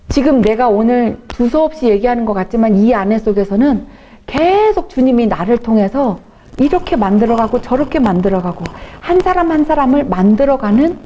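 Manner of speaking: normal talking voice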